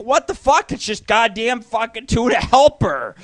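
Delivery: high, loud incredulous outburst; exasperated disbelief. Retold or imitated. Cook reacting to girlfriend's raving over dinner, exasperated disbelief. Acts out his baffled reaction.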